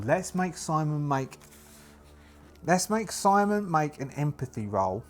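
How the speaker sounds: ordinary talk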